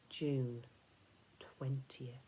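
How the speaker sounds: soft whisper of the date; dread whisper. A narrator's reading. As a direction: let the shock sink in hushed.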